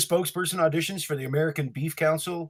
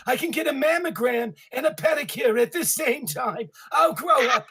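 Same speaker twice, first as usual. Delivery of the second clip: much higher raspy shouting comic; Joan Rivers impression; 9 semitones higher than usual. Beef ad audition as Miss Rivers, high brassy yelling. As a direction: yell the jokes like a brassy comedienne. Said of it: "Miss Rivers".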